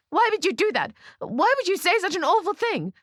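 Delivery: very high, distraught outburst; shrieking. A narrator's reading. Bride protests at Ruth's cruelty, shrieking. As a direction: wail the questions at the top of the range. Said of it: "I shriek".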